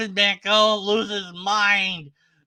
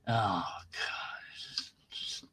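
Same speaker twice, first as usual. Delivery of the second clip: soft unvoiced whisper, lower, defeated; despairing whisper; 8 semitones lower, 12 dB quieter, slower than usual. Real, not acted. Frustrated vlogger whispering in despair over the argument. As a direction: breathe it out as if giving up.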